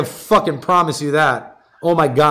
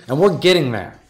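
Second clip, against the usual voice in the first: usual speaking voice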